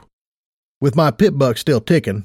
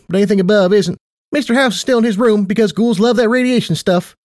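much higher, perky affectionate mare; perky Tight Lips voice; 8 semitones higher than usual. Acted. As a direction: play a bubbly casino boss.

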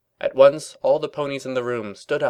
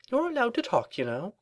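high prim lady voice; 13 semitones higher than usual. Acted. Rarity's voice, high.

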